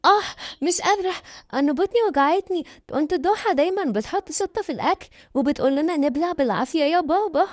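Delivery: high, whining acted tearfulness; fake crying. A narrator's reading. Girl fakes a bellyache to her father, tearful whine. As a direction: whine in pain, pitched well up. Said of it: "بدأت تعيط هي كمان".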